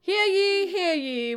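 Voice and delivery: loud ringing proclamation; town crier. Acted. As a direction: call it out to the town square.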